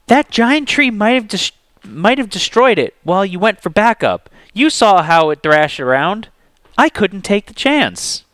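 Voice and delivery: high, heated arguing; angry. A narrator's reading. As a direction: argue back hard. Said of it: "Zeke sounded every bit as angry as Justice".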